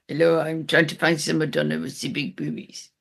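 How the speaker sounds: put-on low-pitched comic French accent; mock French accent. Co-host quoting Allo Allo to the podcaster, mock French accent. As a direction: do a hammed-up French sitcom accent. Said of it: "the whole mock French accent".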